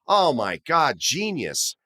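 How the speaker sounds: usual speaking voice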